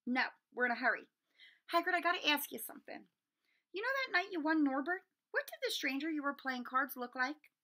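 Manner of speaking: no time to waste; urgent. Acted. Harry urgent questioning.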